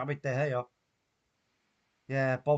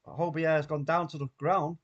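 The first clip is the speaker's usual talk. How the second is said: ordinary talk, nothing marked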